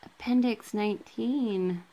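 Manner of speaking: hammed-up high voice begging to hear the appendix; imitating eager viewers. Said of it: "now you guys are like".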